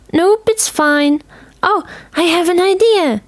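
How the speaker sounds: put-on high, bright girl's voice; girl shrugging off the cold, thrilled by an idea; excited girl. A narrator's reading. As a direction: burst out with a sudden idea.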